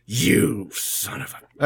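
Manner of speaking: slow, croaky mock-sick voice. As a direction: fake a sick croak, drawn out. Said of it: "I have my sick voice".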